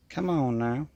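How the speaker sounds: twangy Southern drawl on the words; nasal twang. Real, not acted. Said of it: "I sounded so twangy there".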